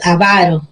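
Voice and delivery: AVARO stressed on VA, a-VA-ro; stress on middle syllable. Shown as a demonstration. Teacher names the stingy person's word, stress on middle syllable. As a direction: lean on the second A of AVARO. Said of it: "que es grave".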